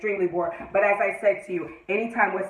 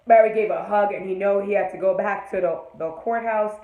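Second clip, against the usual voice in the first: ordinary talk, nothing marked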